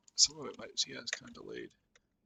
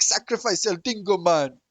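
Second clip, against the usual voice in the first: loud, higher mock Jamaican troll voice; hammed-up Jamaican accent, shouted; 5 semitones higher, 11 dB louder than usual. Imitating raiding trolls for a friend, loud mock Jamaican accent. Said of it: "they're all doing Jamaican accents".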